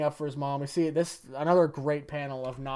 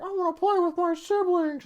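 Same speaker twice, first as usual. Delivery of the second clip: high-pitched put-on little kid voice; Shoto; 14 semitones higher than usual. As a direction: play a pleading child, pitched way up. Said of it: "doing the thing where he's like".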